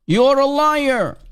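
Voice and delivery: a light mock of the Quraysh; Quraysh accusing. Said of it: "the Quraysh used to call Muhammad".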